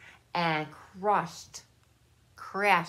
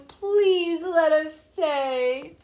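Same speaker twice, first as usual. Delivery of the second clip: slow, high begging voice; pleading penguins; 8 semitones higher, 5 dB louder, much slower than usual. A narrator's reading. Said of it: "said the penguins".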